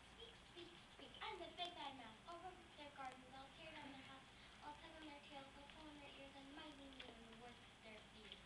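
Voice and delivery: a timid mouse saying its big threat; tiny mouse message. Acted. Mouse tells the butler its threat, hushed and tiny. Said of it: "Please tell them".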